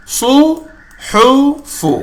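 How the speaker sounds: سو، حو، فو said high and clear; syllable drill. Shown as a demonstration. Teacher recaps syllables of the lesson. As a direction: list سو حو فو syllable by syllable. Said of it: "اسی سبق کا ہے".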